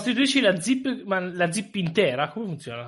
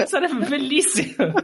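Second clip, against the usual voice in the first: laughing; 7 semitones higher than usual. Real, not acted. Laughing at zipper joke.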